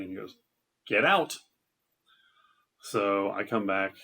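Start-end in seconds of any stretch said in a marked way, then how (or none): 0.9-1.4 higher, louder mock shout of the boss; retold shout. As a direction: bark it like a boss throwing people out. Boss throws girls out.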